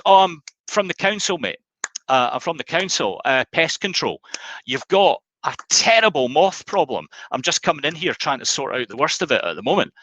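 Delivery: naked lover pretending to be pest control; cheeky council pest man. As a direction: talk your way out like a jobbing workman. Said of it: "the guy says".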